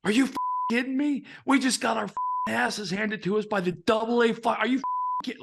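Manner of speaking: high, incredulous tirade; bitter angry coach. Retold or imitated. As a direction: imitate a coach ranting in disgust at the players. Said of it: "John Vukovich was fucking bitter".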